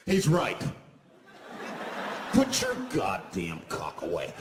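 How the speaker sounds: suddenly brave bus riders piling on; emboldened passengers. Acted.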